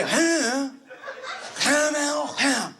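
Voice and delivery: lightly voiced old man grunting; a hint of a deaf old grandpa. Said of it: "He would say".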